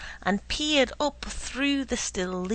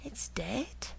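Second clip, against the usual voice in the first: quiet sorrow; 3 semitones higher, 7 dB quieter than usual. A narrator's reading. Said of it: "Mama said".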